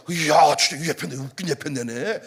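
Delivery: low, exasperated husband's grumble; retold exasperation. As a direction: re-enact grumbling at your wife, pitched low. Retold quarrel with his wife.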